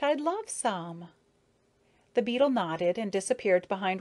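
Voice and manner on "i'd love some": eager. A narrator's reading. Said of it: "Maya burst out".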